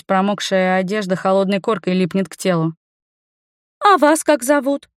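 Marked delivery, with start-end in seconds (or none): 3.8-4.9 put-on higher girlish question; high-pitched, tentative girl; daring young girl. A narrator's reading. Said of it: "осмелилась спросить малая".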